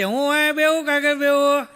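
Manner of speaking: a hint of a tipsy man asking; lightly slurred drunk question. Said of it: "તો ઈ પી ગયેલો હતો". (હળવો નશામાં બોલતો માણસ)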